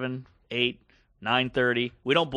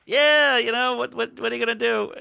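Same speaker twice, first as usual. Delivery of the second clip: high-pitched mock going-along voice; imitated bystander; 9 semitones higher, 3 dB louder than usual. Woman humoring the insistent German man, high resigned agreement. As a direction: humor him, pitched well up.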